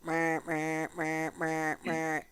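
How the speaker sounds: low, soft droning on one note; mock a dull lecture with an unchanging drone; monotone lecturer. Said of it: "somebody who's monotone in their lecture".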